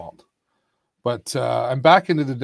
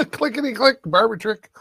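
bingo caller; 9 semitones higher, 5 dB louder than usual. Acted. Bingo-style rhyme call.

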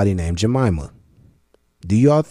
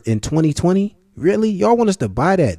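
high, louder disbelief; incredulous challenge; 8 semitones higher, 4 dB louder than usual. Real, not acted. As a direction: question them in a raised, disbelieving voice.

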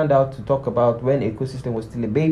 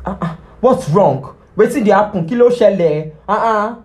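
higher, louder, demanding what's wrong; loud and pushy; 8 semitones higher, 5 dB louder than usual. Shown as a demonstration. Vlogger showing the wrong way to ask, loud and high-pitched. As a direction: fire the questions at them like an alarmed parent.

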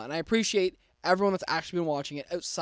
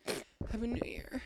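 whisper; 6 semitones higher, 8 dB quieter than usual. Real, not acted. Whispered greeting.

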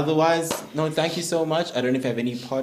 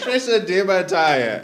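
slow, laughing repeat of the saying; 6 semitones higher, 8 dB louder, slower than usual. Retold or imitated. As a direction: savour each word through laughter. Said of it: "We love the saying".

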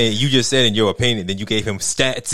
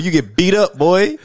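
ordinary talk

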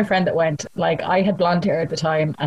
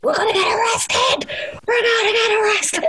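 panicked shout. Retold or imitated. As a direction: shriek it in mock terror, voice cracking high. Panicked retold shout, cry heard.

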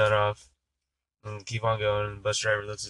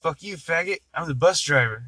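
raised-pitch comeback, lightly acted; mock triumphant retort; 6 semitones higher, 5 dB louder than usual. Disguised bus driver answering the hippie, mock triumphant. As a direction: land it as the punchline, one-upping the taunt. Nun delivers the punchline comeback.

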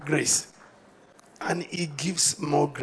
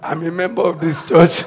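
ordinary delivery

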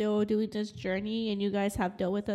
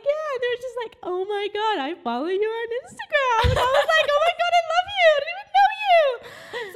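excited; 7 dB louder, faster than usual. Retold or imitated. Retelling fan meeting excitedly.

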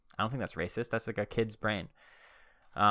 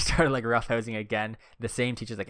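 normal talking voice

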